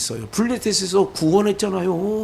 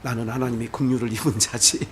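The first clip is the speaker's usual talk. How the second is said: low, soft, self-assured murmur; a believer keeping calm before an angry man, softly; calm and composed; 9 semitones lower, 8 dB quieter than usual. Shown as a demonstration. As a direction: settle yourself calmly. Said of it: "그렇게 하는 거예요".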